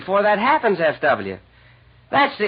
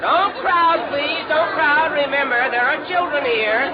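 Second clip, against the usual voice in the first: raised voice, herding a crowd; calling out to crowd; 7 semitones higher than usual. Acted. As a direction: call it out over the noise.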